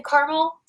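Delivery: caramel said as "CAR-mel"; two-syllable caramel. Retold or imitated. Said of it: "when people say caramel".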